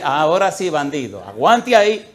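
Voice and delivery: loud, playful triumph; triumphant taunt. Retold or imitated. Pastor gloats at the converted husband, loud playful taunt. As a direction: gloat over him, loud.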